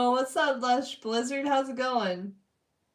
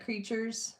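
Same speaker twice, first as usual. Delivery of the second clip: normal talking voice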